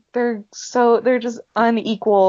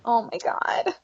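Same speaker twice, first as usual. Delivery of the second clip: breathless hushed gasp of delight; whispered awe; 6 dB quieter than usual. Real, not acted. Fan reacting to the proposal scene, awed whisper. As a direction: whisper it, overcome. Gushing over the second proposal.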